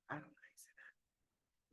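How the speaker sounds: a whispered aside. Real, not acted. Teacher stumbling over a word, whispered to herself. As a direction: drop to a whisper, just for yourself. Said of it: "aside on a hard word".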